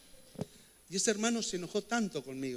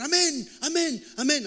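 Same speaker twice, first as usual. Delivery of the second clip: drawn-out amens, higher and louder; fervent amen; 10 semitones higher, 6 dB louder, slower than usual. Retold or imitated. Pastor mocking a congregant's fervent promise in prayer, slow loud amens. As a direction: ham up the zealous believer's amen. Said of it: "cuando decimos".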